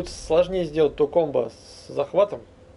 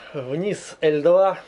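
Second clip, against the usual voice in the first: slow spelled-out input; much slower than usual. Shown as a demonstration. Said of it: "А, вот он".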